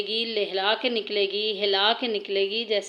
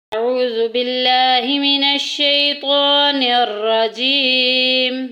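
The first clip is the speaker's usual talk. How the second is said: slow, drawn-out melodic tilawat, louder; Quranic recitation; 5 dB louder, slower than usual. Acted. Teacher opening the lesson for students, in slow melodic recitation. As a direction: chant each word long and ringing.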